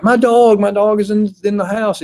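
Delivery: put-on high, loud cry of an old lady losing her dog; frantic old lady. Old lady calls to a jogger about her dog, high and loud. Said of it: "some old lady's like".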